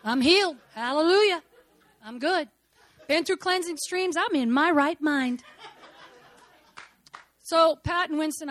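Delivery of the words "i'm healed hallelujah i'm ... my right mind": loud joyful shouts of praise, laughing; exultant praise. Real, not acted. Preacher testifying to the congregation, exultant and laughing. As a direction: call out your healing with joy.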